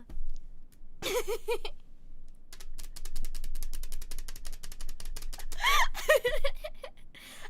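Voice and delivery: long, high, wheezing laughing fit; real helpless laughter. Real, not acted. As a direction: laugh until out of breath. Cracks up at awful book.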